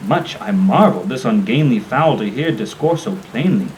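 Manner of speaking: ordinary delivery, nothing marked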